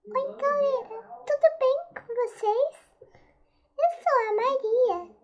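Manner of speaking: high, bright doll voice. Acted. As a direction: talk like a little doll. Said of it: "não zoe meio da voz dela".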